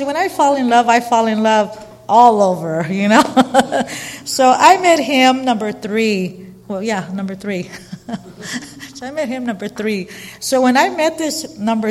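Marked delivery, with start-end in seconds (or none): 3.3-4.2 real amused laugh. Real, not acted. Speaker laughing at her own love life, amused laugh. 7.7-8.9 quiet, voiceless chuckle; soft laugh. Real, not acted. Speaker amused at husband count, soft chuckle.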